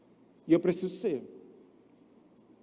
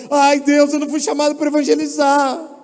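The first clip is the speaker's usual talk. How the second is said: mock whining; 6 semitones higher, 8 dB louder than usual. Mock discouraged evangelist.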